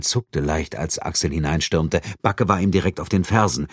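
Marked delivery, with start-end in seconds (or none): none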